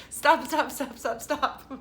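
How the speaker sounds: high, loud little refusals; playful imitation of a child calling quits; imitating Autumn. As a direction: repeat it urgently like a fed-up kid, laughing between. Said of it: "As Autumn says".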